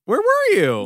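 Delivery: mock-annoyed, high demand; high scolding friend. Band friend scolds late Brandon, high and put-out. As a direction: pester him for being late.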